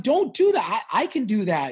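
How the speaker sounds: urgent protest. Retold or imitated. Man stopping his girlfriend doing dishes, high urgent protest. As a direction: jump in to stop her, then offer to take over.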